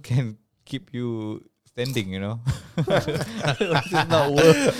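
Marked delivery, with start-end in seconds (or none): 2.5-3.4 a real burst of laughter; bubbling real laugh. Real, not acted. Laughing at own joke. 3.4-4.8 said through real laughter, louder; cracking up mid-sentence; laughing. Real, not acted. Laughing at own joke.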